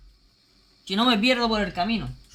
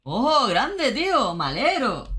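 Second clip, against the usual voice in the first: excited greeting; 3 semitones higher, 4 dB louder, much slower than usual. Real, not acted. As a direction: hail a viewer like a champion. Hailing a viewer by name.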